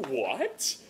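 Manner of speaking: normal talking voice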